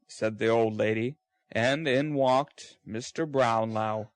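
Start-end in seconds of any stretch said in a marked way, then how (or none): none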